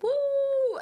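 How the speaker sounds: high-pitched "woo" whoop; party whoop. Retold or imitated. Girls on a night out, imitated party whoop. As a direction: imitate tipsy friends cheering.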